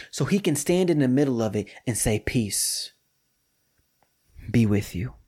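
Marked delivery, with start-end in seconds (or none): 2.2-5.1 gentle blessing. Retold or imitated. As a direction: breathe the words like a blessing. Jesus's gentle greeting, repeated.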